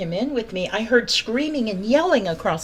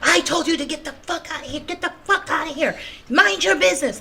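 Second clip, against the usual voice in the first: pitched-up imitation of an angry man yelling; retold screaming; 8 semitones higher than usual. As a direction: imitate him shouting her off, rising. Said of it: "screaming at me, saying the same thing".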